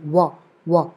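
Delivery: वा as "wa" with rounded lips, low; soft, low वा with a w glide; w sound, no lip-teeth touch. Shown as a demonstration. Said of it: "वा में touch नहीं होता".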